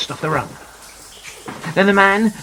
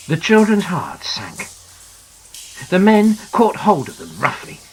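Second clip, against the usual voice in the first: usual speaking voice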